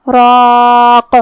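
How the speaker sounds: راق ending in a bouncing echoed قاف, very slow; qalqala. Shown as a demonstration. Teacher shows qalqala on راق, slow. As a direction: stop on قاف with a clear bounce. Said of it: "قاف کو ہلاتے ہوئے یہ کلمہ پہ رکیں گے".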